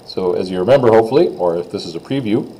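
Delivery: normal talking voice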